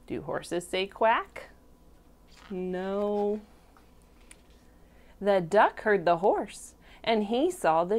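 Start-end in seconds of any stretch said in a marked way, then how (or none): none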